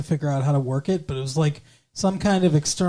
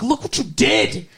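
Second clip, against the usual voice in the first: higher-pitched retold outburst; mock outraged accusation; 5 semitones higher than usual. Retelling her blaming him for the computer, mock outrage. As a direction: throw the blame like an outraged girlfriend, hammed up.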